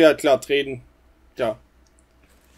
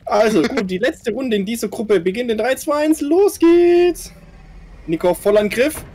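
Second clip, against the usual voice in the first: motorsport commentator; 6 semitones higher than usual. Acted. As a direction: hype up the countdown like a race caller. Host announces final group round.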